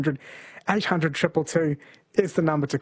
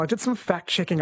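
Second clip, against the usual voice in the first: usual speaking voice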